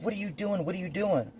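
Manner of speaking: high, softened imitation of sister; retold sister's worked-up questioning. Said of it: "she's all worked out".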